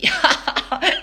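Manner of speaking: high, loud burst of laughter. Acted. Walter laughs after fooling Elmer, loud laugh. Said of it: "S'écrie Walter".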